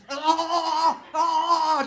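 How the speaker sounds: high, loud panting kissing sounds; loud, high mock smooching gasps; mock kissing noises. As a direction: ham up frantic making-out noises. Mimics frantic making out.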